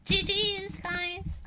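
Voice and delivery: lightly put-on cheer to needle the other players; mock-friendly. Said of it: "be toxic in Overwatch by saying".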